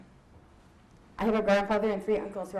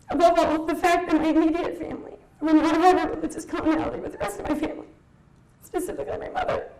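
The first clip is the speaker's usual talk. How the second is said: near tears; 6 semitones higher, 4 dB louder than usual. Real, not acted. Tearful talk about leaving family faith.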